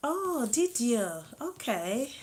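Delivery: loud, high, drawn-out reaction; real surprise. Real, not acted. Host reading a viewer's news in the chat, pleasantly surprised. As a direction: react with swooping, impressed surprise.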